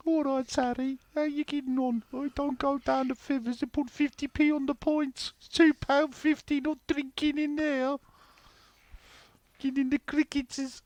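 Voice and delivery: high-pitched, exaggerated Brummie; Brummie accent. Podcaster mocking the Brummie accent to co-hosts, hammed-up high Brummie. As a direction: put on a squeaky mock Brummie voice. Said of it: "That Brummie accent's gotta be the worst".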